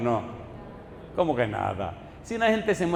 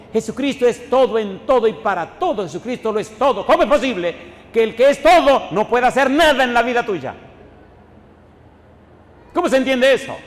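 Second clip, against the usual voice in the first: full-force preaching; 4 semitones higher, 4 dB louder than usual. Acted. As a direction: build to a thundering climax. (predicación a todo pulmón, fuerte y enfática)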